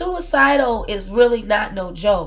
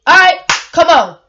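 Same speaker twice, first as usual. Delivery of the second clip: defiant; 6 semitones higher, 7 dB louder than usual. Retold or imitated. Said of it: "I'm like".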